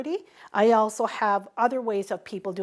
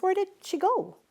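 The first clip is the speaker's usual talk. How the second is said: high puzzled question to the others; ask, caught off guard; surprised question; 9 semitones higher than usual. Retold or imitated. Asking the other participants where the elder went, high and surprised. Finds the elder gone at dinner.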